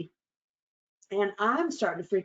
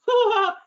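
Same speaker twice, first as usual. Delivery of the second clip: loud high burst of laughter; a big laugh at her own bit; 8 dB louder than usual. Real, not acted. Laughing at her own punchline.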